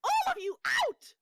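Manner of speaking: very high, loud orders; shrieking. Acted. Hostess driving intruders out of her house, shrieking. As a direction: shriek at the whole group. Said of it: "hearing the shrieking".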